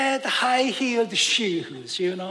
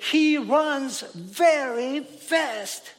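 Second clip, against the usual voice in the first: slow, even demo of a plain sentence; slow example sentence; 3 semitones higher, much slower than usual. Shown as a demonstration. Preacher slowly reading an English grammar example. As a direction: spell it out slowly for the class. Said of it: "we say".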